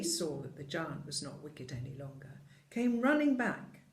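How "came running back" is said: ordinary delivery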